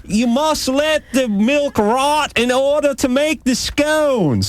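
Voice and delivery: very high, slow mock-English insistence; hammed-up falsetto Englishwoman laying down a rule; mock English girlfriend. Host mimics girlfriend explaining her recipe, mock English girlfriend. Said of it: "I had this English girlfriend".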